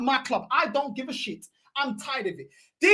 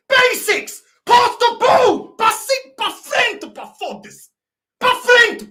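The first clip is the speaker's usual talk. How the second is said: high, loud shouts at a player; exasperated yelling; 8 semitones higher, 8 dB louder, slower than usual. Real, not acted. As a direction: yell it like a fan at the match. Yelling at player who can't pass.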